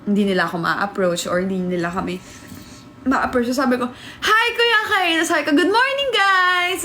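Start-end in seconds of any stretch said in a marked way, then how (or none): 4.2-6.9 much higher, cheery hello; bright greeting. Retold or imitated. Late arrival greeting the waiting van, high cheery hello. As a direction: greet everyone brightly to cover embarrassment.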